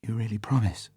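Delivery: soft, hopeful question through tears; tearful hope. A narrator's reading. Vesper to Bond, grateful through tears, soft. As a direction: ask softly, still teary. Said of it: "through her tears".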